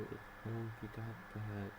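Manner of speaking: ordinary delivery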